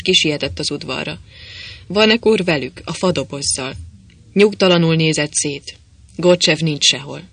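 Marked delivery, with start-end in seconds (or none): none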